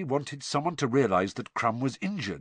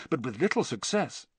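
ordinary delivery, nothing marked

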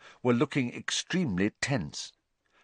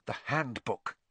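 quiet thought; 10 dB quieter than usual. A narrator's reading. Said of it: "he thought quickly".

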